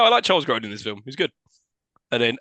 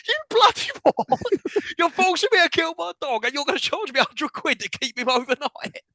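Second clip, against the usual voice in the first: high, hammed-up outraged yell at the vet; mock furious rant; 14 semitones higher than usual. Rants at the receptionist over boarding charges, mock outrage. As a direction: rant like a cheated dog owner, pitched way up. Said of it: "I'm like".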